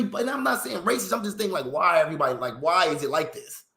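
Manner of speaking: usual speaking voice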